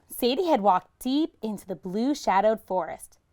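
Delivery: ordinary delivery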